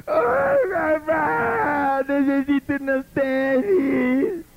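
high crying mock plea, slow; hammed-up tearful begging; mock wailing child. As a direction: wail for sneakers like a melodramatic prayer. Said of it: "lo vas a llevar al manicomio".

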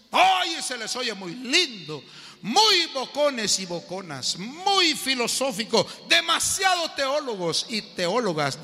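loud, slow, emphatic sermon delivery; fiery preaching. Acted. Preacher rebuking those who boast of knowing God, loud and emphatic. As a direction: hammer each point home to the congregation.

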